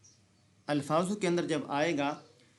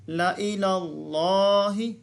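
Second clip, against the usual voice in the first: slow, louder chanted recitation; Quranic recitation; 3 semitones higher, 6 dB louder, much slower than usual. Shown as a demonstration. Teacher showing how to read the verse. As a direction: draw out इलल्लाहि with a full heavy lam. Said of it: "कैसे पढ़ेंगे?".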